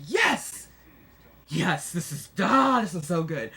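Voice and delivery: gleeful yes. Retold or imitated. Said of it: "I was like".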